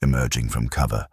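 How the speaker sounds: plain reading voice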